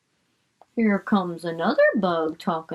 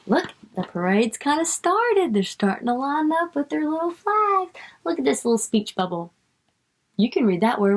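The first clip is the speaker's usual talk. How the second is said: ordinary talk, nothing marked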